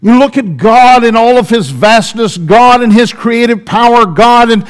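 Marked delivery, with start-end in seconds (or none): none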